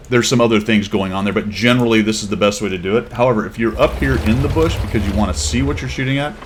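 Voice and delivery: ordinary talk, nothing marked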